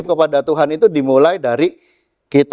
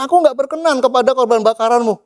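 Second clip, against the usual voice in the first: high-pitched, mildly angry quote; acted indignation, raised pitch; angry God; 9 semitones higher than usual. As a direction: imitate God's displeased rebuke. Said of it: "Tuhan marah".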